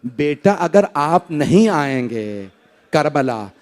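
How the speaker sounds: hushed loving father's voice; tender father. Acted. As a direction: whisper it gently like a father to his infant. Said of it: "मौला हुसैन ने फरमाया होगा".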